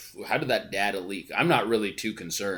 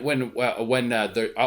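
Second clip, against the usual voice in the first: normal talking voice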